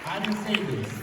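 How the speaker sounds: ordinary delivery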